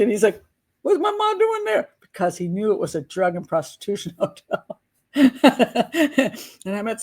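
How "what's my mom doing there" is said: high, shocked question; a son stunned that his mom is staying there; astonished son. Retold or imitated. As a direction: squeak it in disbelief.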